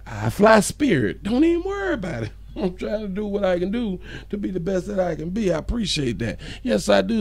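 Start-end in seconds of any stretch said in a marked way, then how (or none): 1.2-2.3 "Don't even worry about it" quick and much higher; breezy brush-off. Acted. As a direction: toss it off fast and high, carefree. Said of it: "repeated catchphrase".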